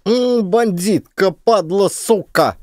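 high, slow, cursing; boy swearing. A narrator's reading. Boy curses the bandits angrily. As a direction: spit out the curses. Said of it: "ругнулся пацан".

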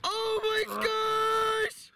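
cheering a huge catch; excited shout. Real, not acted. Fisherman sees the massive grouper, excited shout.

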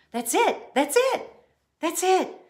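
high, bright repeated cry of discovery; excited exclaiming. A narrator's reading. Girl solves the tooth fairy mystery, excited exclaiming. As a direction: shout it as the idea hits. Girl's sudden realization after gasp.

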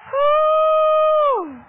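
long high, loud woo; ecstatic whoop. Real, not acted. Preacher overcome before her congregation, ecstatic whoop. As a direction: let out a soaring loud whoop.

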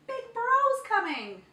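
high loud excited call; chimp shout. A narrator's reading. Chimpanzee warning the elephant, loud high call. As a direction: call it out wildly. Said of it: "whooped the chimpanzee".

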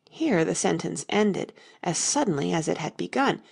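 ordinary delivery, nothing marked

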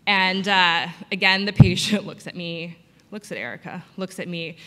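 ordinary delivery

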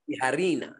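"Tijarina" with a rolled R and a Spanish j; "Tijarina" said carefully, hee-ha-REE-na. Shown as a demonstration. Guest modelling his name for the host, rolled R. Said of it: "Gotta roll that R".

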